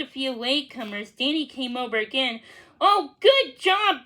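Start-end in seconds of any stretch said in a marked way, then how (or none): 2.8-4.0 praising. Acted. Danny praising the scouts.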